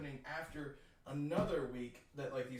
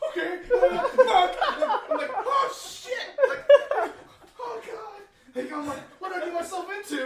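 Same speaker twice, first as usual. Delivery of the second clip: much higher, laughing panic; high panicked; 14 dB louder than usual. Retold or imitated. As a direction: yelp in dread. Retold panic.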